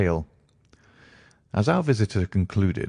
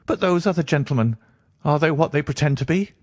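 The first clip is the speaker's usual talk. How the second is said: higher, reedy voice, wary; suspicious nervous patient; 8 semitones higher than usual. Acted. Patient doubting the doctor's companions, high and suspicious. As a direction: question them in a high anxious voice.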